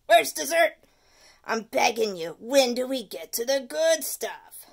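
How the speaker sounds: loud, begging gripe; complaining tooth. Acted. The tooth griping about vegetables, loud. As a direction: beg for dessert.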